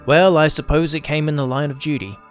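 plain reading voice

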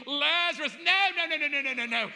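high, loud, rapid-fire refusal; mock panicked cry. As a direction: wail out a hammed-up objection.

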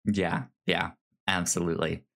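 normal talking voice

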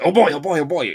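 chirpy, yelping sidekick dog; Muttley. Retold or imitated. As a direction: yip it out like an excited cartoon dog. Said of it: "I can do his".

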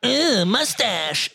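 high, very slow mocking whine; mock Tyler impression. Teasing cohost Tyler, slow mock voice. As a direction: drag it out as the cohost.

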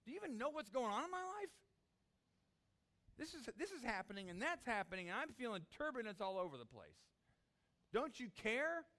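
high, loud, insistent pleading; desperate outcry. Acted. Believer demands Jesus notice their life, desperate and high. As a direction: cry out as if nobody is listening. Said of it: "the desperation of".

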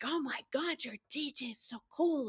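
everybody cooing over her DJ job, mock gushing; mock gushing fan. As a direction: squeal it like an impressed friend. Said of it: "everybody's like".

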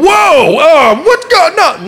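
high, loud, drawn-out mock alarm; hammed-up yell of shock; panicked freak-out. Jesus seeing the convulsing boy, mock panicked. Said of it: "don't for a minute think Jesus is going".

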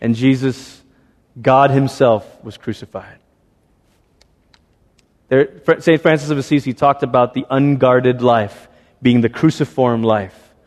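ordinary talk

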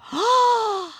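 high, loud gasp; gasp of realisation. Acted. Boy sees the umbrella could work, high sudden gasp. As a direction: gasp as the idea suddenly lands.

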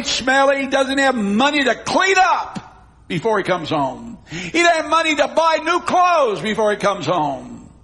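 high, driving sermon delivery; impassioned preaching. Acted. Preacher paints the filthy, unwanted son for congregation, impassioned preaching. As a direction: pile up the list with rising force.

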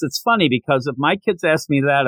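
normal talking voice

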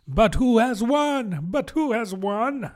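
high, drawn-out repeated question; eager crowd asking. A narrator's reading. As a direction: clamour for the winner in a high voice. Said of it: "panting and asking".

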